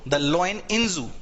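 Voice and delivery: drawn-out reading of The lion in zoo; slow example. Shown as a demonstration. Teacher showing students how to phrase it. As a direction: say it slowly, word by word. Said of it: "ऐसे बोल दो".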